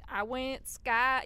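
put-on southern accent. Quoting a song to co-host, southern accent. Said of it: "I'm just doing a southern accent by accident now".

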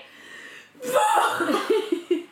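high wailing laugh-sob. Retold or imitated. Grace breaks down.

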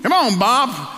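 high, rising call to a mule. Retold or imitated. Farmer calling to his mule.

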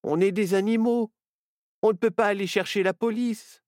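high, helpless complaint; distraught little fox character; lamenting. A narrator's reading. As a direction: protest despairingly in a high voice. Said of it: "se lamenta Nao".

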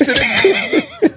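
squeaky laughter through the words; can barely get it out for laughing. Real, not acted. Telling mates the coach got drenched, high laughing voice.

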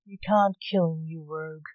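usual speaking voice

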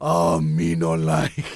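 slow, loud, dim-witted Hulk voice; dopey cartoon bellow. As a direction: bellow it slowly like a dumb green giant. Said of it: "the green Hulk of handy".

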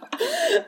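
a sudden high laugh at her own joke; high burst of laughter. Real, not acted. Laughing at her own Tinder-profile joke, high burst of laughter.

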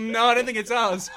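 loud, high imitation of evasive locals; mock denial. Imitating farm people disowning the peacock, mock denial. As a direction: put on a high voice of feigned innocence.

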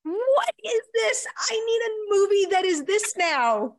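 host shrieks with delight at the pitch; delighted outcry. Real, not acted. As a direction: cry out in thrilled disbelief. Host thrilled by sequel idea.

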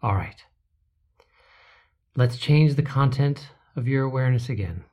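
ordinary delivery

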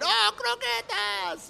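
high, slow, delighted exclamation; excited cheer. Retold or imitated. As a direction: crowd welcoming a favorite food. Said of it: "the whole place exploded".

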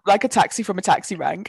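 ordinary delivery

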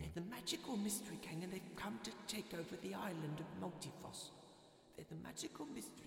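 very soft, high muttering to himself; under his breath. A narrator's reading. Small man making sure he isn't dreaming, muttering. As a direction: repeat it quietly, fading away. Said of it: "repeated it to himself several times under his breath".

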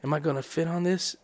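very soft, low, furtive question; hushed, almost whispered aside; whispering. Retold or imitated. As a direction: ask it under your breath. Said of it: "You're, like, whispering".

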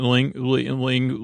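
usual speaking voice

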